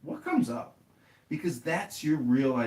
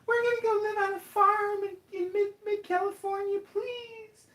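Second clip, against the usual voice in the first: much higher, squeaky put-on couple voice; sweet cute couple. Cute couple asking for the RV, high squeaky mock voice. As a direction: play it cute and innocent. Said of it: "the cute couple is like".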